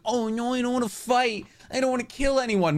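high, whiny mock hero voice. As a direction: whimper it like a timid pushover. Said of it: "He's like".

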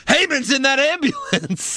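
high-pitched mock commentator exclamation; hammed-up ringside call; Cole. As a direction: imitate an alarmed announcer. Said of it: "and Cole just says".

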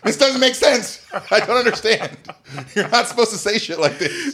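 high, loud comic disbelief; mock bafflement. Comic reacts to progressive words in Southern accent, mock bafflement. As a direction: protest in bewildered, high-pitched outrage.

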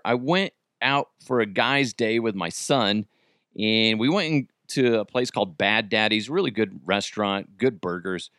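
ordinary delivery

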